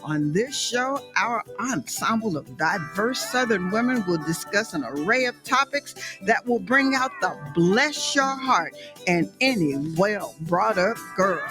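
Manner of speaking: usual speaking voice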